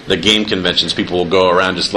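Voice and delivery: ordinary talk, nothing marked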